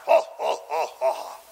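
staged evil laugh, dropping low; deliberate mock-villain laughter. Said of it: "And then he laughs".

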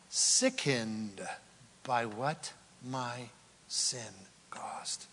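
preacher asking softly, almost whispering; fading whisper. Real, not acted. Enacting conviction.

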